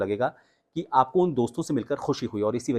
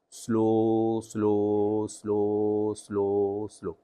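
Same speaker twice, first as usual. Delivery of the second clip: slow drill; 2 semitones lower, much slower than usual. Shown as a demonstration. Coach demonstrates the slow drill for students. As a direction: stretch each slow slowly. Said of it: "उसी tone के साथ बोलना है".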